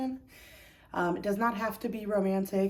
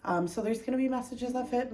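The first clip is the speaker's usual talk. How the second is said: usual speaking voice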